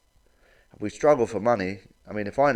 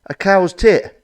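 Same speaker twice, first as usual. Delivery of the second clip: punchline; 7 semitones higher, 8 dB louder, slower than usual. Pretend. Punchline to milk advert.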